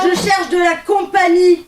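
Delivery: high, loud shouted answer; child demanding attention at full voice. Acted. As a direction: yell it back insistently. Screaming child answers back.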